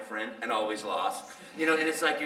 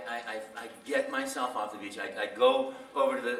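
ordinary talk, nothing marked

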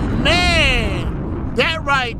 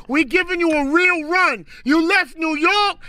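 normal talking voice

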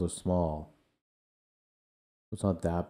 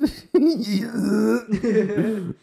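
squealing laugh, much higher; high shocked laugh; 6 dB louder than usual. Real, not acted. Laughing at the gross video.

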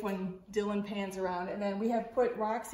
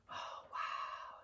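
breathless whispered wow; girl whispering in amazement; awed whisper; 14 dB quieter than usual. Acted. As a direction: whisper it in wonder. Annie awed by valley.

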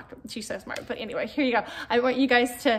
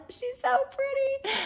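high, slow cooing at the dog; doting coo; 13 semitones higher, 4 dB quieter, slower than usual. Real, not acted. Talking sweetly to her dog.

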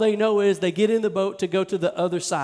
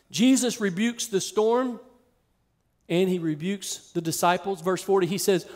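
usual speaking voice

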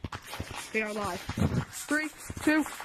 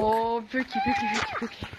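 sing-song calling; 7 semitones higher, 4 dB louder than usual. Real, not acted. Calling a friend's nickname.

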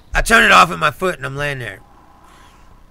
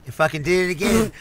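ordinary talk, nothing marked

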